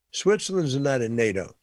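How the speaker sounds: ordinary delivery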